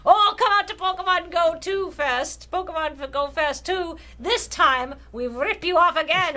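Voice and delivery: generic villain voice. Acted. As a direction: sneer it like a cartoon villain. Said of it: "That's just my generic villain voice".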